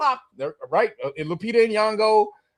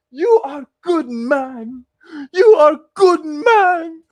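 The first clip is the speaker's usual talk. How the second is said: slow, sobbing broken English, the second line higher and much louder; mock crying; 5 semitones higher, 7 dB louder, much slower than usual. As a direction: wail it out like a hammed-up death scene. Mocking the dying girl's words.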